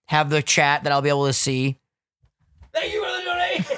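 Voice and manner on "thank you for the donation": high, shouted streamer thank-you. Pretend. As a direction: hype streamer thanking chat. Mimics streamer thanking donation.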